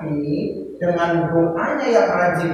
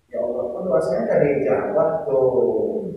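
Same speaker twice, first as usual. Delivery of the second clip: lower, softer, slow sulky grumble; mock sulky prayer; complaining prayer; 6 semitones lower, 6 dB quieter, slower than usual. Shown as a demonstration. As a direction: act out the wrong way to pray, sulking. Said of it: "Ngomongnya gimana sih, doa?".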